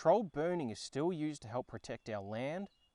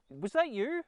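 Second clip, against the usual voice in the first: mock accusing; 10 semitones higher, 5 dB louder than usual. Host accuses a pet of mischief.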